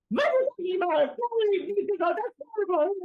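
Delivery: high, rapid cartoonish protest; Pepe Le Pew. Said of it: "Pepe Le Pew's like".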